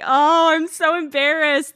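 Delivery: high, flustered little gasp of embarrassment; mock embarrassed. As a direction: play the flustered missionary girl. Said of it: "I was like".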